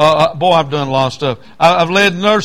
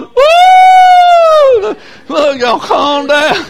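very high woo, then a slow shouted calm down; mock shouting counselor; 7 dB louder, much slower than usual. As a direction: imitate a counselor hollering over the noise. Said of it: "the counselors was going".

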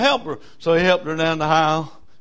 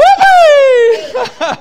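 loud high laugh; 15 dB louder than usual. Real, not acted. Teller laughs at story.

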